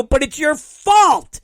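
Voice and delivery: high, loud shout of blame; accusing outburst. Retold or imitated. Passenger blaming agent for missed plane, accusing outburst. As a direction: explode in indignant protest.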